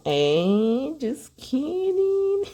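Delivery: high squeaky teasing giggle; laughing it off. Real, not acted. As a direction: laugh away your own joke. Joking after a cheeky remark.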